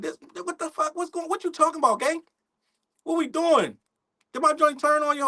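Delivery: high-pitched, incredulous questioning; baffled disbelief. Retold or imitated. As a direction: retell your bewilderment, voice climbing high. Retells his baffled reaction to Ray.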